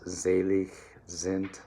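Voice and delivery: "Selig" begun with a buzzing Z, slowly; German Z sound. Shown as a demonstration. Coach showing singers the Z sound, slow and clear. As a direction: lean into the Z of "Selig". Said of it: "so those Z sounds".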